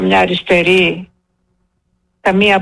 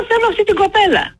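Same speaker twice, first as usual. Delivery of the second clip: high-pitched protest, acted out from memory; re-enacted caller demanding in a high voice; retold insistent demand; 10 semitones higher than usual.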